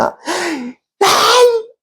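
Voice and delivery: very high, strained mock scream; piercingly high cathartic cry; demonstrated yell. Shown as a demonstration. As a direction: act out screaming to vent. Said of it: "있는 대로 없는 소리 쳐".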